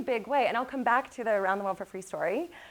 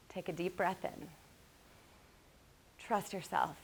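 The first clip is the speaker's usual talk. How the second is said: guided calming; 3 semitones lower, 5 dB quieter than usual. Retold or imitated. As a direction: talk her down gently, with long pauses. Mom leads a calming exercise.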